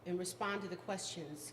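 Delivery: ordinary delivery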